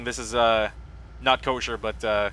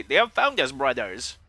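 funny voice; slower than usual. Retold or imitated. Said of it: "in a very funny voice".

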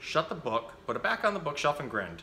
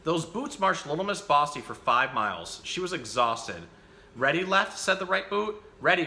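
put-on lively picture-book reading for children; animated bedtime-story reader; story narration; 3 semitones higher than usual. A narrator's reading. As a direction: read the story out with bouncy, emphatic phrasing.

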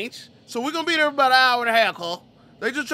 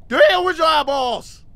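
slow, loud and higher, amazed; shocked shout; 8 semitones higher, 6 dB louder, slower than usual. Real, not acted. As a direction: yell it out in disbelief. Shocked at a character's face.

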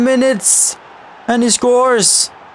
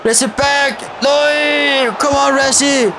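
high, urgent shouts at the screen; frustrated shouting; 6 semitones higher than usual. Real, not acted. Gamer yells at his winger, frustrated shout. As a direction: yell at the player to press.

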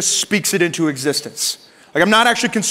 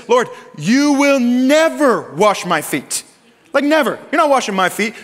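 adamant refusal; 6 semitones higher than usual. Retold or imitated. Peter's adamant refusal, acted.

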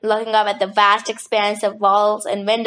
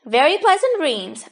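ordinary delivery

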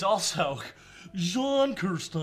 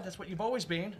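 ordinary delivery

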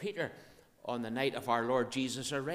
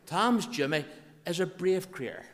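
loud, raised Ulster Scots teaching line; emphatic Ulster Scots, pitched up; broad Ulster Scots; 5 semitones higher, 6 dB louder than usual. Shown as a demonstration. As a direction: proclaim the dialect phrase piece by piece. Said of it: "speak Ulster Scotch".

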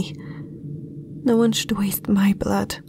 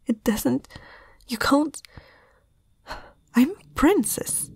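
usual speaking voice

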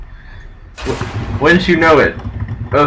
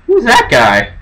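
surprised; 8 semitones higher than usual. Real, not acted. Startled by new ghost.

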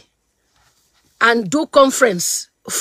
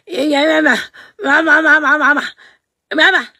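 mock wailing; faster than usual. Mocking the daughter's crying.

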